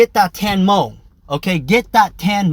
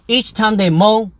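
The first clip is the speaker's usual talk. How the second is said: ordinary talk, nothing marked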